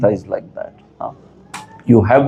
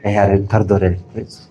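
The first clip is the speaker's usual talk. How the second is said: mock pleading king; 4 semitones lower, much faster than usual. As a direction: beg like a friend, not a king. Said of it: "ऐसा बोलता है राजा?".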